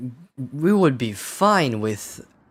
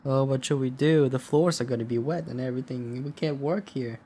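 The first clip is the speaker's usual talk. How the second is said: lightly voiced fretting coworkers, a bit higher; mildly mocking, raised-pitch worry; mock worried workers; 4 semitones higher than usual. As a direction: imitate anxious, helpless questions. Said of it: "They have questions about tomorrow".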